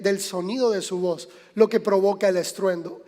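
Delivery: ordinary talk, nothing marked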